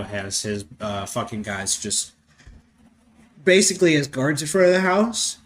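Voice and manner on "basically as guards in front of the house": words stretched through a big yawn, higher and louder. Real, not acted. Commenting on the movie while yawning. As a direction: yawn right through the line. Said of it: "Oh, sorry, yawning".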